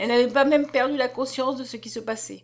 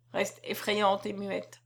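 usual speaking voice

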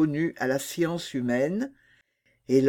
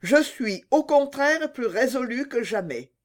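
put-on higher, firm girl voice; a young princess refusing; young mermaid; 9 semitones higher than usual. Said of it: "répondit la jeune princesse".

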